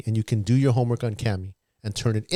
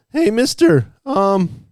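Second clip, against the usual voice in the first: put-on high, loud kid voice; squeaky eager student calling out; mock high-pitched student; imitated student voice; 10 semitones higher, 6 dB louder, much slower than usual. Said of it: "this is how they sounded".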